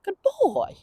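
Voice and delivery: high, upbeat praise; praise for heel. Shown as a demonstration. Trainer showing viewers how to praise heel. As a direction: show the praise voice, lifted high. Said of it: "we say".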